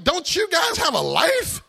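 loud, high, laughing disbelief; incredulous teenager. Retold or imitated. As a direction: scoff at the churchy friends. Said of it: "I'm like".

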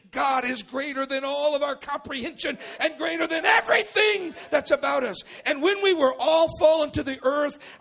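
high, loud, shouted preaching; preaching at full force. Real, not acted. Pastor preaching on the light, full-force shout. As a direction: thunder it to the congregation.

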